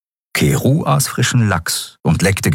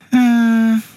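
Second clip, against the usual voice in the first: hohes nachdenkliches Hm. Acted. Nelen überlegt.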